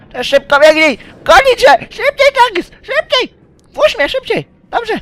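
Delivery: very high, loud frantic squeal; squeaky panicked voice. Acted. Gamer voicing a frightened character urging Jackix to run.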